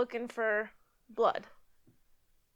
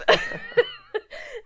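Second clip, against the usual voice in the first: real laughter, high and bright; high burst of laughter; 14 semitones higher, 4 dB louder than usual. Real, not acted. Wife laughing while retelling the prank on her.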